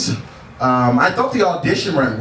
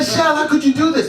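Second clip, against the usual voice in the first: put-on fast, high-pitched African mother accent; imitated African mom, upset and quick; 12 semitones higher, much faster than usual. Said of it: "She's African, by the way, that's the accent I'm doing".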